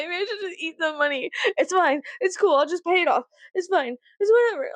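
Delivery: high-pitched, whiny retold panic; squeaky imitation of freaking out; mock tweaking. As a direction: talk yourself down in a high, panicky voice. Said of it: "I was genuinely tweaking".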